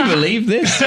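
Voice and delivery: high indignant quote; mock posh outrage. Complaining to her MP in the atrium, high mock outrage. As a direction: pitch it up, scandalised.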